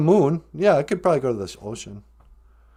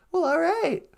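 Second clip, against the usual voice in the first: grandma character voice; 13 semitones higher, 4 dB quieter than usual. Pretend. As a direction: chirp it like a sweet old granny. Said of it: "Nana Bonjour".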